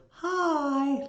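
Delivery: a hint of a shy manager's hi; imitating a cute hi. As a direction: copy her sweet little greeting. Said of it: "like that".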